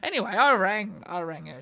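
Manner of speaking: ordinary talk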